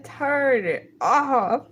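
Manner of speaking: slow pleading drawl; slow drawn out plea. Real, not acted. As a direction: stretch each word slowly.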